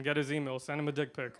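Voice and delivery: usual speaking voice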